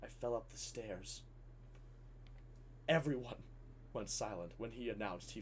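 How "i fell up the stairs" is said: low, very soft deadpan; hushed, toneless announcement. Retold or imitated. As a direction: state it quietly with no feeling. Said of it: "deadpan, silent, and then just goes".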